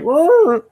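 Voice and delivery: high, loud growly noise through a gag; muffled gagged cry. Acted. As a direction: strain to yell with your mouth covered. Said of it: "whatever's covering his mouth".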